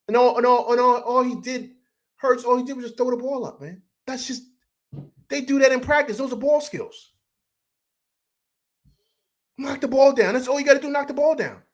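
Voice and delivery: high-pitched, exasperated fan venting. Real, not acted. Fan venting over a basic mistake, exasperated. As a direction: protest it in a high, incredulous voice.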